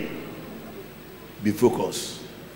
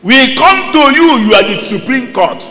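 higher, louder pleading accuser; loud, high appeal to the court; accusers' appeal; 7 semitones higher, 5 dB louder than usual. Acted. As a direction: call up to the judge as the accusing crowd. Voicing the accusers before Jesus.

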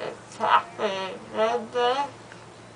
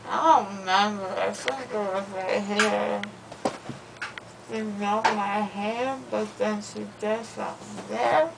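groggy anesthesia slur; slurred, drowsy, loopy speech; after wisdom teeth. Real, not acted.

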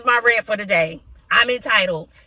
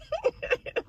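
exasperated laugh; 9 dB quieter than usual. Real, not acted. Exasperated laugh mid rant.

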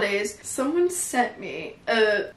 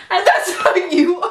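high, loud words through laughter; real amused teasing laugh; 8 semitones higher, 5 dB louder, slower than usual. Real, not acted. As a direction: laugh while drawing the words out slowly. Laughing at the co-host's admission.

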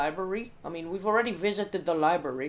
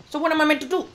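higher and louder, fed up; exasperated outburst; 11 semitones higher, 12 dB louder, much faster than usual. Real, not acted. Gamer fed up with locked doors, loud exasperated outburst. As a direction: throw the question out in frustration.

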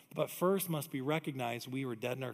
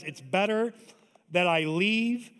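drawn-out, deliberate promise; Jesus, slow and weighty; 4 semitones higher, 9 dB louder, slower than usual. Retold or imitated. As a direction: let each word land slowly. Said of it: "Jesus says".

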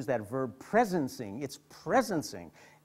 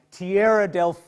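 louder, mock conductor's call; retold yell; 3 semitones higher, 9 dB louder than usual. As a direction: call it out like a station announcement. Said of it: "yells out something like".

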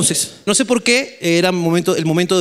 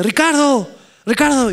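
mamá llamando; 9 semitones higher, slower than usual. Retold or imitated.

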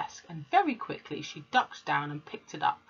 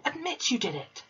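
mock roar; 9 semitones higher than usual. A narrator's reading. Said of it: "roared the Trunchbull".

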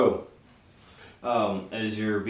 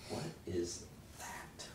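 whispered; 14 dB quieter, slower than usual. Acted. Player voice reacting, whispered.

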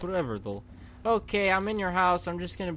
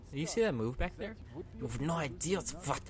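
ordinary delivery

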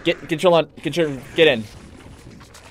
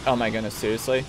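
ordinary talk

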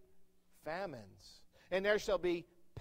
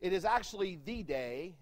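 normal talking voice

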